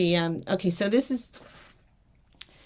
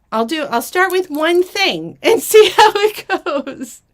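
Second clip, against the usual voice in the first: nervous laughing; 7 semitones higher, 7 dB louder than usual. Real, not acted.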